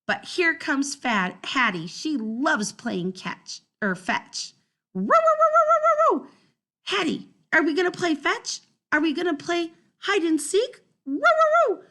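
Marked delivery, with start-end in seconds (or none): 4.9-6.3 high, loud barking rows; excited barking. Acted. Dog barks excitedly. 6.9-10.8 eager. Acted. Dog asks eagerly. 11.1-11.8 high, loud dog barks; excited barking. Acted.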